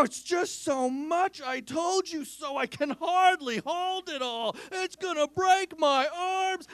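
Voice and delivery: high, slow, straining under an invisible load; mock burdened niece. Uncle acting out niece hauling nothing, hammed-up strain.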